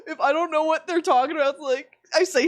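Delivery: usual speaking voice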